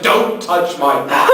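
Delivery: slow, emphatic mock command; stern outburst. Host slamming his box shut on a guest, stern. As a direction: imitate an indignant owner, each word drawn out.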